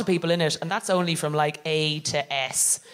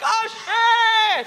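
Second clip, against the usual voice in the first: much higher, louder shocked yelp; panicked shout; 5 dB louder than usual. Retold or imitated. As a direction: blurt it out in sudden horror. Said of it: "And I'm like".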